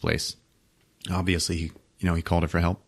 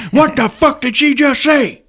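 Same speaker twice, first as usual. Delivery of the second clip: much higher, louder, outraged squawk; indignant boss; 6 dB louder than usual. Retold or imitated. Boss outraged at the signed insult, high squawk. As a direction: play the outraged boss in a squeaky voice. Boss reacts to sign language.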